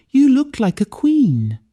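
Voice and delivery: proud and lifted, a bright mother's praise. Acted. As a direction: beam with pride at her daughter. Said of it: "she said proudly".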